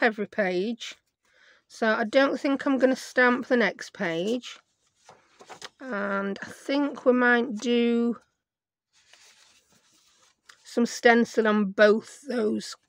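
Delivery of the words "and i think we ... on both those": croaky voice. Real, not acted. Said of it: "Excuse my croaky voice".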